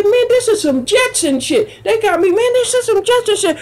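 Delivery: high-pitched retold amazement; amazed exclamation. Ex-prisoner amazed by an automatic faucet, high-pitched disbelief. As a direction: marvel at it with the pitch way up.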